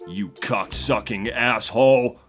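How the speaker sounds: put-on American inflection; casual American impression; American accent. Shown as a demonstration. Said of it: "with an American inflection".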